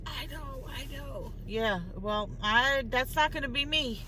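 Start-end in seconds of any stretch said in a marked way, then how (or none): 0.0-1.3 mimicking a smoker, soft and worn out; imitated smoker's voice. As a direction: answer softly as the terrible-sounding smoker. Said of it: "she's talking like this".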